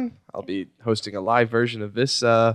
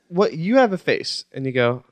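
usual speaking voice

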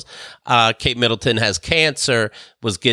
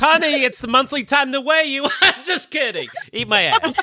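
higher, giggly put-on English husband accent; mock husband voice; 11 semitones higher than usual. Prince teasing his wife, high put-on English accent. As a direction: tease like a mock royal husband. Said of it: "He goes".

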